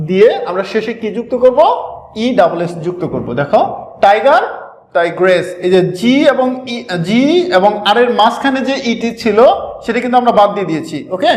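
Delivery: normal talking voice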